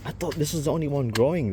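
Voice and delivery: amazed, straining to move it; excited. Real, not acted. Huge potato won't budge.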